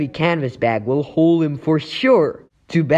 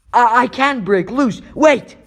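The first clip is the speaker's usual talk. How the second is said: sudden urgent cry; 7 semitones higher than usual. Acted. Hero trapped, sudden idea.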